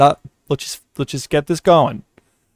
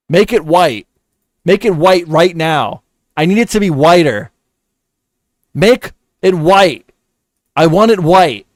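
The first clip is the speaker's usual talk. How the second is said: frustrated demands; 3 semitones higher, 5 dB louder than usual. Real, not acted. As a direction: order the program around, losing patience. Yelling at Photoshop to comply.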